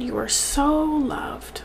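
slow, whispered, on the verge of tears; choking up. Real, not acted. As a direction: let the words break into a teary whisper. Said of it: "I'm gonna cry".

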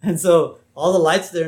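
normal talking voice

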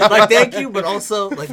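laughing. Real, not acted. Laughing punchline.

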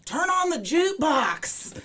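high, drawn-out heckler's call, no real volume; mock yell. Angry bar crowd interrupting the comedy show, mock yelling. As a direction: imitate a bar crowd calling out slowly. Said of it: "they'd start yelling".

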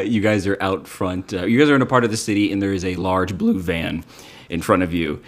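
ordinary delivery

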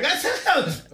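high, loud mocking girly voice; mocking imitation. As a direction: mimic her shrill and loud. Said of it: "She's like".

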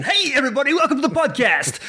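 high, hyped-up host greeting; podcast voice. Shown as a demonstration. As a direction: switch on the over-peppy presenter voice. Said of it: "The, the podcast voice kicked in".

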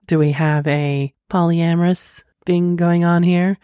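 normal talking voice

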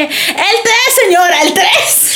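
high, fed-up repetition; losing patience with her; exasperated. Retold or imitated. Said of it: "yo le dije".

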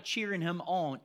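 ordinary talk, nothing marked

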